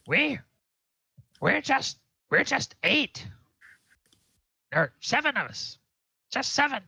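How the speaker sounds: high-pitched goblin voice, halting; goblin prisoner. Acted. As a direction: answer as a cornered little goblin in broken phrases.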